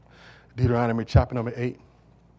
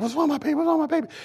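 Peggy; 14 semitones higher, much faster than usual. Retold or imitated. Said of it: "Peggy's looking like".

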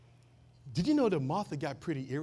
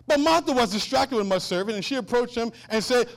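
loud proclamation of the verse; preached scripture reading; 5 semitones higher, 9 dB louder than usual. Real, not acted. Preacher setting up Martha's complaint, proclaiming. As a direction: build toward the character's outcry.

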